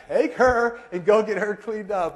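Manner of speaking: loud, high, slowed mock command; exasperated order. Wife handing husband the messy baby, loud mock exasperation. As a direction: imitate a fed-up wife giving orders.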